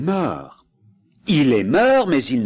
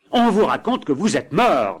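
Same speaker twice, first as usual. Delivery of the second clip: brutal; 7 semitones higher, 4 dB louder than usual. Acted. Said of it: "dit la femme brutalement".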